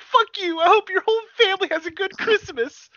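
put-on a kid screaming into voice chat; imitating a raging gamer. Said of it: "and it's like".

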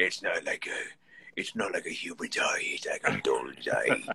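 low, slow, breathy storyteller with a spooky hush; grim old sailor. Retold or imitated. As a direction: drop low and slow like a grizzled fisherman telling a tale. Mock shark-hunter monologue.

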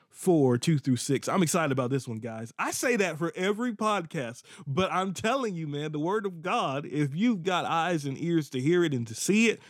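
ordinary talk, nothing marked

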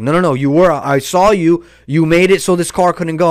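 imitating spazzing man. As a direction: play a man angrily insisting he saw it. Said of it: "he was spazzing".

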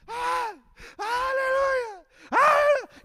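very high, drawn-out cries; high-pitched imitation of ecstatic yelling; imitated screaming. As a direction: scream praise as the overcome young man. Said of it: "el muchacho empezó a gritar".

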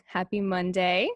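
usual speaking voice

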